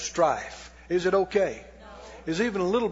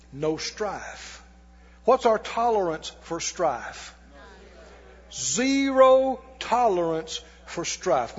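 ordinary delivery

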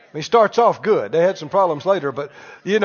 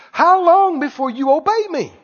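raised, louder-pitched demand; exasperated rebuke; 7 semitones higher, 4 dB louder than usual. Acted. God to a disobedient people, exasperated rebuke. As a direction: put God's impatience into a high, insistent question.

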